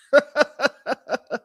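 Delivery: short real laugh; amused laugh at a chat comment. Real, not acted. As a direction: burst out laughing.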